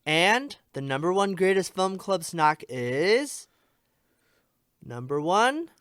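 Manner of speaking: ordinary delivery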